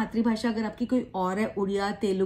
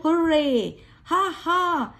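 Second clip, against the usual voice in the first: a bright, higher cheer; happy exclamations; 5 semitones higher, much slower than usual. Shown as a demonstration. Teacher shows students happy words, cheering. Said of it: "जब खुश होते हो तो आप बोलते हो".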